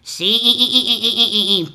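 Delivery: bleating, stuttering goat. Acted. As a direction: stammer like a goat through a laugh. Goat answers.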